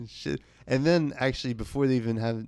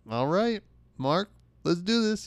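higher, louder eager acceptance; excited yes; 8 semitones higher, 6 dB louder than usual. Retold or imitated. Founder accepting Mark's offer, thrilled and loud. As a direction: jump at the deal with glee.

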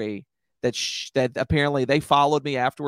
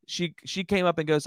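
ordinary talk

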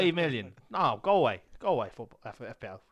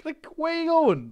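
mock outrage; 13 semitones higher, 4 dB louder than usual. Mocking the price.

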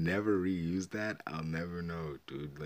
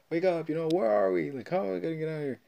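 high, loud pleading to wake up; mock frantic wake-up call; acted urgency; 11 semitones higher, 6 dB louder, faster than usual. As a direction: imitate someone urgently rousing a friend. Voicing her shaking him awake.

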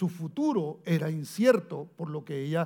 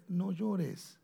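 soft comfort; 2 semitones higher, 5 dB quieter, much slower than usual. Retold or imitated. Jesus comforting the widow.

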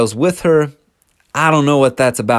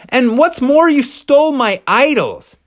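outraged accusation; 12 semitones higher than usual. Retold or imitated. Laban accusing Jacob of stealing his idols. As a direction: throw the final charge at him. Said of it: "he's like".

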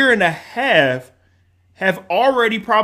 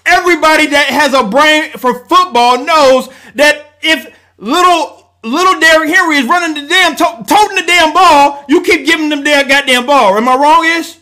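high, loud, furious yelling; screaming rant; 7 semitones higher, 9 dB louder than usual. Real, not acted. Fan rants at cohost about the coaches, screaming furiously. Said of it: "I apologize, everybody, for screaming".

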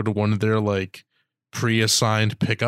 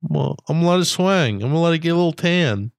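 lightly voiced carefree homeless person, louder and higher; defiant homeless person; 5 semitones higher, 3 dB louder, faster than usual. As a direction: play a brazen, couldn't-care-less type. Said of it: "some of there's just people are like".

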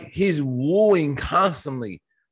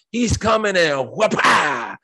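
slow, higher playful shouted whoop-ah; mock whipping shout; 5 semitones higher than usual. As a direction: act out a belt swing with a half-joking yell. Mimics a belt spanking.

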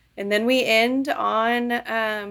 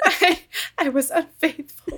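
giggling through the line, higher and slower; can barely get it out for laughing; laughing at the melodrama; laughing quote; 8 semitones higher, slower than usual. Retold or imitated. Repeating the quote while laughing.

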